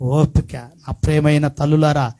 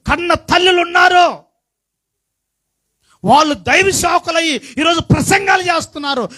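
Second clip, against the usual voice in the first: fiery preaching; 6 semitones higher than usual. Acted. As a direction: preach it out to the congregation. Preaching rises to full force.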